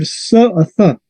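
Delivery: "S o Z" as bare "s" and "th" sounds; soft C sounds. Shown as a demonstration. Teacher gives soft Spanish C sounds, hissed S and Z. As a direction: sound out S and Z, not letter names. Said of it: "en español tenemos dos sonidos".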